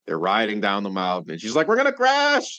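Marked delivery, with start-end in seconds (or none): 1.7-2.6 very high, loud alarm; hammed-up squeal of panic; panicked cry. Child riding down the mountain with the Grinch, mock panicked cry.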